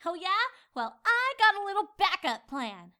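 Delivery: high, loud defiant retort; determined. Acted. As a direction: challenge him head on. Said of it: "she said, determined".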